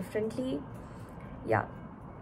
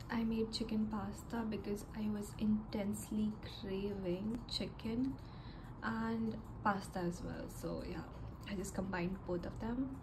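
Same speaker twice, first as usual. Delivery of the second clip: whispering; 11 dB quieter than usual. Real, not acted. Whispered lunch chat, quiet late.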